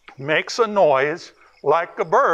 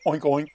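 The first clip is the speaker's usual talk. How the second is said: mock pig oink from a bird call; wrong bird call; 4 semitones lower, 3 dB quieter than usual. Ranger Rex blowing his bird call, out comes an oink. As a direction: squeal out a silly oink. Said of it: "So here we go".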